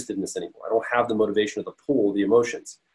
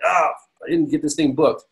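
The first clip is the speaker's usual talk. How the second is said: higher, imitating his own frustrated blast; mock frustrated outburst; 6 semitones higher than usual. Speaker imitating himself fuming over an airline booking, mock frustrated blast. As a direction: act out a small frustrated rant at yourself. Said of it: "Why am I blasting this emotion out like".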